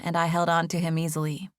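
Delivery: usual speaking voice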